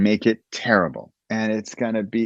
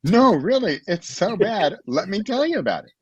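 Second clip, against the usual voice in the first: much higher, gleeful insistence; mock-enthusiastic pitch, much higher; eager to tell; 9 semitones higher than usual. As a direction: gush about how bad it is. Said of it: "It's like".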